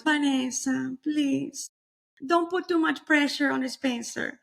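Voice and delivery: high, slow, pleading motherly voice; dramatic mom. Retold or imitated. Mom gently warning her daughter not to pressure Spencer, high and slow. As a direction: lay it on like an award-winning mom. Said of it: "Golden Globes".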